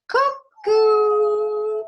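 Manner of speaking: high, loud cuckoo-clock call; a clock bird calling out. Acted. Cuckoo pops out of tree.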